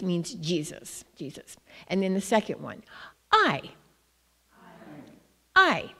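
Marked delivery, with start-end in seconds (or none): 3.3-5.9 "I" said as ai with a falling tone. Shown as a demonstration. Missionary teaching the word for love, falling tone. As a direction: drop the pitch on "I", ai. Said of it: "so it's a falling tone".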